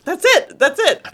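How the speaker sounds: high-pitched eureka, then settling; lightly acted sudden recognition; excited realization. Telling friend she realized she's Dee, excited. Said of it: "I was like".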